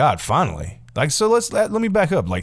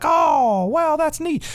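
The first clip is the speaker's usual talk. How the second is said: mock impressed; much slower than usual. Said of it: "Everybody's like".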